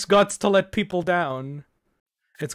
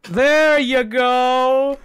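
excited; 6 semitones higher, 7 dB louder, much slower than usual. Real, not acted. Cheering a game moment.

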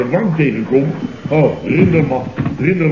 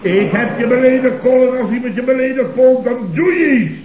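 indignant raised voice; 8 semitones higher than usual. Acted. Boss demanding Paul insult him, raised and indignant. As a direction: pump up the outrage, voice climbing.

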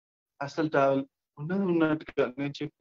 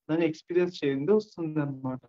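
normal talking voice

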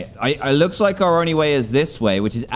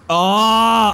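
loud high "ah!"; frightened scream; 10 semitones higher, 6 dB louder than usual. Real, not acted. Player entering the nether, frightened scream.

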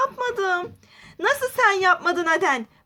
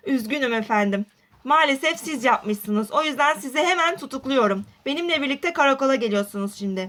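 deep police officer voice; 6 semitones lower than usual. Acted. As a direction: sound like a stern police officer. Polis tutukluyor, alçak ses. (tutuklayan sert polis sesi)

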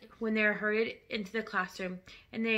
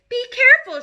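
high, loud warning shriek; 13 semitones higher, 13 dB louder than usual. Acted. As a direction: shriek it in alarm at a clumsy husband. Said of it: "shrieked the mother".

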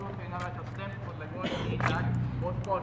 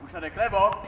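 drill command; 2 semitones higher, 9 dB louder than usual. Real, not acted.

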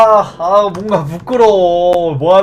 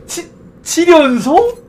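stunned disbelief; 8 semitones higher than usual. Real, not acted. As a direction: freak out at the streak. Seventh success in a row.

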